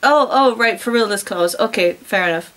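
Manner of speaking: ordinary delivery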